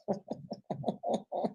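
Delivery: a soft, airless chuckle; quiet breathy chuckle at the wife's dare; breathy laugh. Real, not acted. Laughing at the story.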